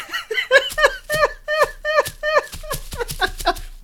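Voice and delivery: high, loud cackling laugh; shrill laugh. Acted. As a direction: cackle at his foolish guess. Mocking the wrong guess.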